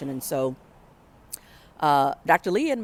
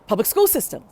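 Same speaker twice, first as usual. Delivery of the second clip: higher, louder called-out answer; yelling out; 8 semitones higher, 6 dB louder than usual. Retold or imitated. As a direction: call it out with confidence. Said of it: "we yell out".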